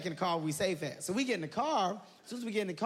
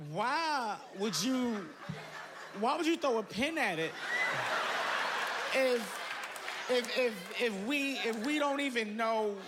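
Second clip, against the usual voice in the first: emotional whine; 2 semitones higher, slower than usual. Retold or imitated. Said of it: "So I got a little emotional".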